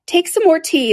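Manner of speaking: high, earnest offer; insistent high-voiced host. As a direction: press it on the guest, pitched high. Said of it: "said to Alice very earnestly".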